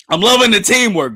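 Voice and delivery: high, loud burst of approval; excited cheering. Real, not acted. Streamer praises the team mid-fight, cheering loudly.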